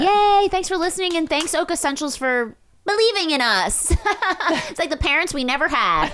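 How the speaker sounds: high, bright gushing thanks; giddy thanks. Real, not acted. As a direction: gush with excitement.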